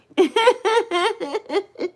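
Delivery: high giggling run; giggle. Real, not acted. Streamer naming chat friends, giggling. Laughs while greeting viewers.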